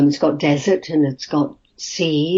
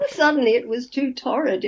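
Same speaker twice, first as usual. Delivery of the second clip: laughing disbelief; 7 semitones higher than usual. Real, not acted. Actress scoffs at the censors, laughing in mock outrage. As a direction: laugh through it, higher, in amused mock outrage. Mocking the British censors' verdict.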